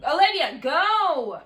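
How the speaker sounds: loud call across the house; yelling. Real, not acted. To her sister in the next room, a loud yell. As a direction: shout her name to make her leave.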